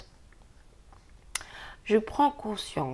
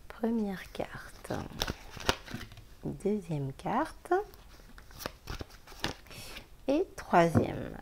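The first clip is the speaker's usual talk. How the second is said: whispered card draw; 8 dB quieter, much slower than usual. Real, not acted. Drawing love oracle cards.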